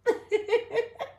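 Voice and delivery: sudden high-pitched burst of laughter. Real, not acted. Streamer amused by a viewer's greeting, high laugh.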